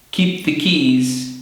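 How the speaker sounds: "Keep the keys" slow, every consonant clear; slow demo. Shown as a demonstration. Teacher drilling learners, slow and careful. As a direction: spell it out slowly for learners. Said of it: "Key, keep".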